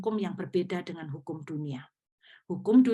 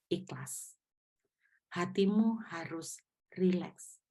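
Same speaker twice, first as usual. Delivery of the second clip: gentle counsel; 2 semitones lower, 4 dB quieter than usual. Retold or imitated. Preacher counsels gently on phone.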